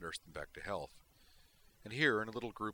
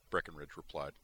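ordinary delivery, nothing marked

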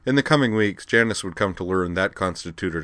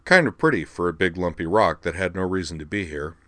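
plain reading voice

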